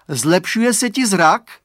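high, loud, slow hopeful question; boy checking on his patient; asking. A narrator's reading. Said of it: "Otázal se".

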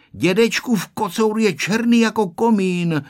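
put-on high-pitched child's assertion; firmly certain. A narrator's reading. Honzík doubts the written tomcat, firmly certain. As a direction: state it with a small boy's certainty. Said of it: "řekl určitě Honzík".

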